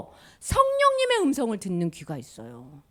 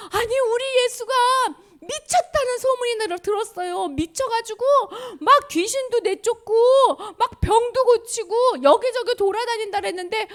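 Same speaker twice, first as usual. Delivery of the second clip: 높고 울먹이는 하소연; a high, teary worried plea; tearful mother; 11 semitones higher, 3 dB louder than usual. Acted. 가족이 예수 소문에 하소연. (울먹이며 높게 하소연)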